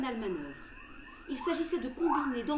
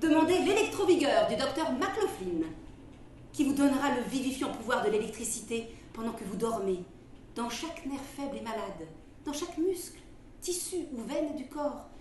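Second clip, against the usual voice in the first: ad read; 2 semitones higher, 5 dB louder than usual. Acted. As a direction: sell it like an old radio ad. Reads a quack remedy advertisement.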